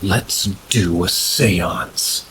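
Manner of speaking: slow, drawn-out eerie suggestion; a hint of a creepy slow voice; friend suggesting a seance. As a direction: lean into it slowly, like a spooky dare. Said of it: "my friend goes".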